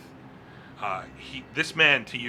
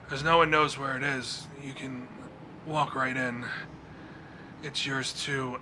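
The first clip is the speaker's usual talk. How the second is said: higher, far-off trance voice; entranced man; 3 semitones higher than usual. Acted. Spellbound captive revealing the temple's location, in a dazed trance.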